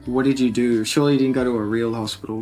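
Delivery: ordinary delivery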